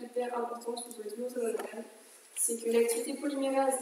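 ordinary talk, nothing marked

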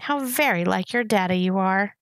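usual speaking voice

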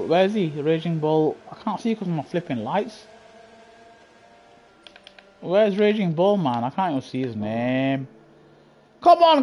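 ordinary delivery

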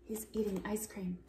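quick whispered aside. Acted. Narrator telling audience about shark's snack, whispered. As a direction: hush it like gossip.

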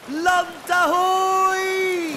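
long, drawn-out high shout; slow, loud shout across the deck; lookout's shout. Acted. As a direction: call out from the crow's nest. Lookout spots land.

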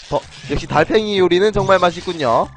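ordinary talk

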